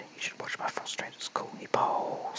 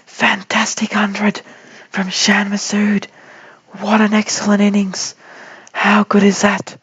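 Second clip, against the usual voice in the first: excited shout; 11 dB louder than usual. Real, not acted. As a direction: celebrate the century loudly. Celebrating the batsman's hundred.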